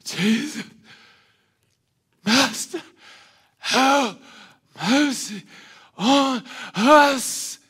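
loud, high pleading cry; crying out for mercy. Retold or imitated. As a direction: call out to Jesus, begging for help. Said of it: "You have to say".

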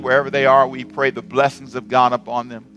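fervent prayer. Acted. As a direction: lift the room in closing prayer. Closing prayer over music.

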